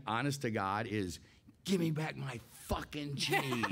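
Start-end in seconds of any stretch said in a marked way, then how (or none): 1.7-3.6 a higher, laughing hint of the creature voice; lightly voiced creature cursing, laughing; half-laughing creature curse. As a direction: blurt the demand through laughter. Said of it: "'Cause what's in my mind".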